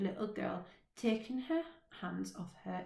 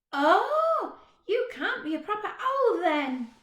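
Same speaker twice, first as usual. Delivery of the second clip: put-on high, chatty little girl, a bit bossy; 12 semitones higher, 12 dB louder than usual. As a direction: play a cheeky small girl. Little girl's voice in story.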